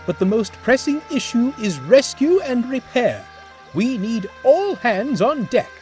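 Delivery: rally the crew; commanding manager. Acted. Manager takes charge of rescue.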